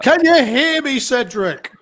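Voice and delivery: high-pitched mock shout down the line; calling out. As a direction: call out as if he can barely hear. Mock calling to headset-less co-host.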